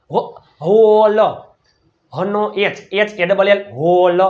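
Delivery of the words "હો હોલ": હોલ built from a held "ho", slow and louder; sounding out. Shown as a demonstration. Teacher breaking the word down for pupils, drawn-out sounding out. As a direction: stretch the vowel of હોલ out, loud and slow.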